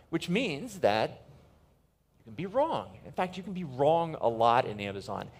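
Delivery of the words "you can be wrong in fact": ordinary talk, nothing marked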